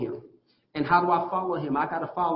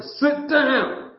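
raised-voice parental command; demonstrated yell; 7 semitones higher than usual. Shown as a demonstration. Father demonstrating how he yells at his son, raised voice. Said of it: "Now, here's me yelling. Yelling, I don't go to the top of voice".

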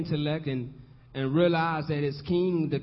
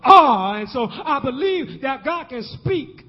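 preaching; 6 semitones higher, 5 dB louder than usual. Real, not acted. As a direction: preach it out loud, rising on the big words.

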